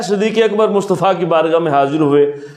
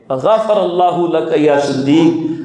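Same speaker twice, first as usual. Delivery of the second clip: slow recitation; 3 semitones lower, much slower than usual. Shown as a demonstration. Repeating the Arabic prayer drawn out.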